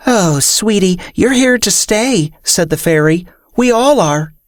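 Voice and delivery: high, sugary fairy voice; sweet, patronizing fairy. Acted.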